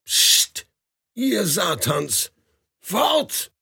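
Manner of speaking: hiss, then a high sharp shout; hissing at cats. Acted. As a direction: shoo the cats away in a hiss. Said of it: "zischte Aljoscha".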